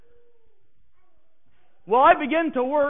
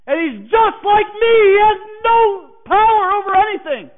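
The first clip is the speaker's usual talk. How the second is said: loud, high, sneering accusation; scornful tirade; 7 semitones higher, 7 dB louder than usual. Retold or imitated. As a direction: jab at each man he points to. Coworker points out hypocrite Christians.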